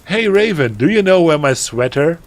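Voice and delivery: put-on high, loud, gushing teenage voice; melodramatic teen author. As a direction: read it like an overexcited goth girl. Reads author's note in character.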